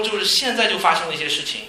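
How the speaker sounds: normal talking voice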